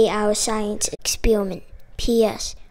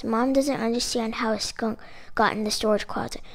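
ordinary delivery

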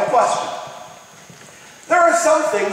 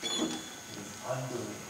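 hushed awe; 8 semitones lower, 16 dB quieter, faster than usual. Retold or imitated. Fireman remembers Ground Zero.

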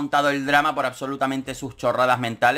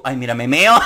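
ordinary talk, nothing marked

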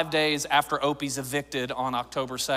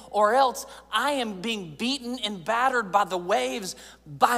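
raised, impassioned sermon voice, slowing at the end; preaching; 5 semitones higher than usual. Acted. Pastor urging his congregation to pray, rising preaching fervour. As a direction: build the plea toward the climax.

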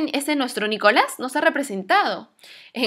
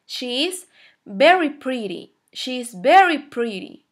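slow model sentence, VERY picked out strongly; stress on VERY; slower than usual. Shown as a demonstration. Teacher models saying someone is very pretty, emphatic VERY. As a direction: hit VERY, spacing the words for learners. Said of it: "es lo mismo que so".

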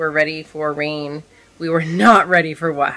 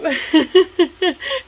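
high burst of nervous laughter; 11 semitones higher, 4 dB louder than usual. Real, not acted. Nervous laugh in thunderstorm story.

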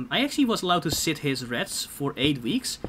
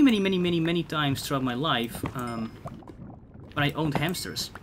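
usual speaking voice

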